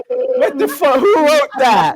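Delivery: high, incredulous squeal of disbelief; who wrote that, said through a shocked high squeal. Real, not acted. Host reading the cripple award, squealing in shocked disbelief.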